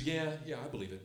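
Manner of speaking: soft, unconvinced yeah; half-hearted. Retold or imitated. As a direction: mumble agreement without conviction. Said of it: "Kinda half-heartedly".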